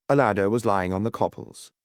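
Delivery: ordinary delivery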